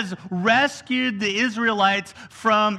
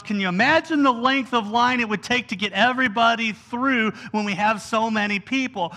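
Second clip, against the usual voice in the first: ordinary talk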